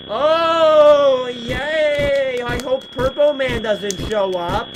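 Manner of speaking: loud, high, drawn-out hammed-up cheer; mock cheering. Player acts Foxy hoping Purple Man stays away, mock cheer. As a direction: cheer theatrically, then slow down on the hope.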